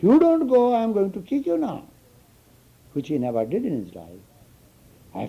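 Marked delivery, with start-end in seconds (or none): 0.0-1.8 high, loud threat to a stubborn student; mock angry threat. Guru threatens his student with a loud, high mock scolding. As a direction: bark it like a stern teacher losing patience.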